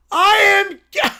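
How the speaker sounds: put-on high, loud, tearful child shout; crying bratty kid. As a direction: wail it like a jealous little boy. Caillou declares his name.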